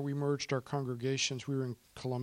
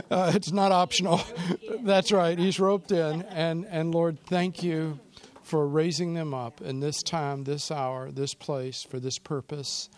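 fervent prayer; 5 dB louder than usual. Acted. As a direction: pray aloud for the couple, louder and rising. Pastor leads closing prayer.

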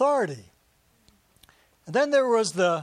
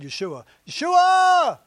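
loud, slow, drawn-out cry; calling out; 10 semitones higher, 7 dB louder, slower than usual. Retold or imitated. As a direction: call the name out across the road. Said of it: "He probably said".

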